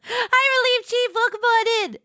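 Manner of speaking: high-pitched squealed welcome; excited greeting. Real, not acted. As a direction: greet an arrival with gleeful high energy. Calling a greeting to arriving viewer.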